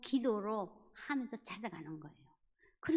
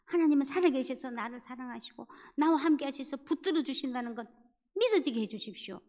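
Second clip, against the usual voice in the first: fervent prayer, building louder as it pleads; a heartfelt plea to God, rising in force; earnest prayer; 3 semitones higher, 4 dB louder than usual. Retold or imitated. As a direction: pray with urgent intensity. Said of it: "막 간절하게 기도를 했어요".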